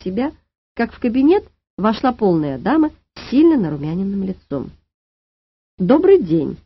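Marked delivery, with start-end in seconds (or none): 5.8-6.6 louder, stony official greeting; каменный голос. A narrator's reading. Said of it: "каменным голосом произнесла она". (каменным голосом)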